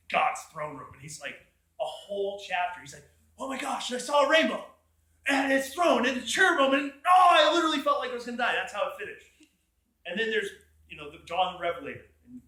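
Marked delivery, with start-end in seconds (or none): 3.4-7.3 loud, high and breathless with awe; overwhelmed cry, louder and higher; awestruck prophet. Retold or imitated. Ezekiel overwhelmed by God's throne. 7.3-8.5 loud, high, rushed and overwhelmed; fast, loud cry of awe; awestruck exclaim. Retold or imitated. Ezekiel's awestruck cry, first take.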